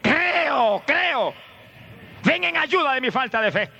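grito de súplica, agudo y alargado; clamor a toda voz. Acted. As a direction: clama muy alto, como padre desesperado por ayuda. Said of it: "dice que clamó a toda voz". (clamando a toda voz)

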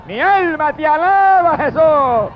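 high, drawn-out shout of praise; soaring, stretched-out devotional exclamation; exultant cry of praise. Real, not acted. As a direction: cry it out slowly to heaven. Preacher cries out praise after testimony.

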